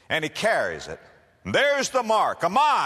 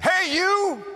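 much higher, louder shout; calling out; 7 dB louder than usual. Acted. Roman soldier shouts for the man, loud call. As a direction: yell across the field.

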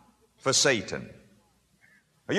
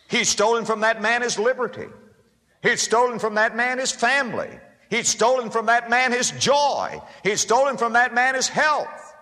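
building preacher's list; 6 semitones higher than usual. Real, not acted.

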